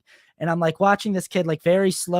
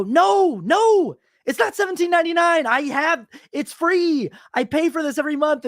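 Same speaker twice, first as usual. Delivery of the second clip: exasperated outburst; 10 semitones higher than usual. Retold or imitated. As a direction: replay the high-pitched indignant objection. Retold outburst at ticket counter.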